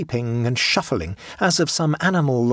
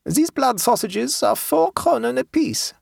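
put-on high German-accented haggler; German peasant woman; 13 semitones higher, slower than usual. A narrator's reading. Woman names her price.

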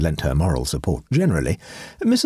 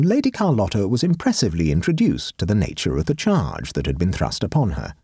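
ordinary delivery

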